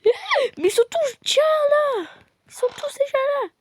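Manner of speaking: high, loud surprised cry. Pretend. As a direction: gasp that everyone's already here. Surprised that guests arrived.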